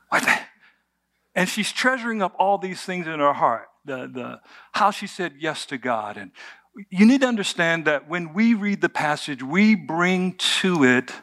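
usual speaking voice

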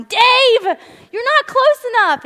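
high, loud call across the water; yelling to husband. Retold or imitated. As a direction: shout to Dave that he's too far. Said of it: "I'm yelling".

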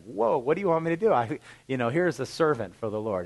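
put-on high, fast, flustered old priest; startled Eli. Old Eli handed a small child, startled. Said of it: "can you imagine Eli".

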